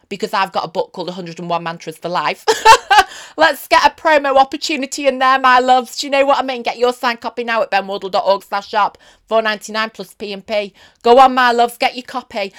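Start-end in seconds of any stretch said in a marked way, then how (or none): 2.5-3.3 loud laugh. Real, not acted. Laughs at own plug. 3.4-12.5 loud, hammy sales pitch; shameless plug. Pretend. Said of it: "oh, it's shameless".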